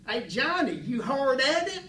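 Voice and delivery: high, loud, playful echo of a country catchphrase; Angie Dickinson. Retold or imitated. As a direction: imitate an actress teasing the talk show host. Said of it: "first words out of her mouth were".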